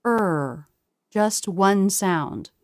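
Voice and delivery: a drawn-out American er vowel, lips pushed forward; er sound. Shown as a demonstration. Teacher to learners, slow demo of "er". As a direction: hold "er" slowly as a single sound. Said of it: "but will be pronounced".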